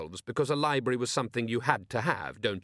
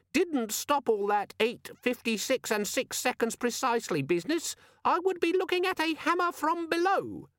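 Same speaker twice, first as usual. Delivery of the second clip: very high, squeaky tirade; indignant squeaky demon; 14 semitones higher than usual. A narrator's reading. Demon quoting Vimes's threats back at him, indignant squeak. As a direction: complain pedantically in a high gadget voice. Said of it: "gave him an angry look".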